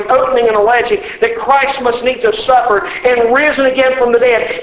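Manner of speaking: normal talking voice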